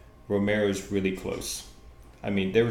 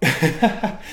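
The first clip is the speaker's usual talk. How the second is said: laughing; 7 semitones higher, 8 dB louder than usual. Real, not acted. Host cracking up over his own bad accent, laughing. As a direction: burst of laughter into the word.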